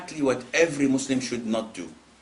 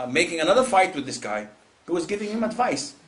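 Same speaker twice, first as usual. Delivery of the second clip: normal talking voice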